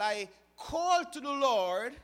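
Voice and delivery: slow, loud call upward; a drawn-out plea to heaven; crying out to God. Shown as a demonstration. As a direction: call out to the Lord slowly, as if pleading. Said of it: "yelling to the Lord".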